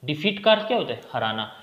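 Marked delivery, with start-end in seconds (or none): none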